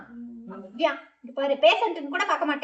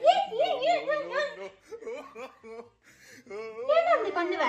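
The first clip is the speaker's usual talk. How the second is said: laughing crying. Real, not acted.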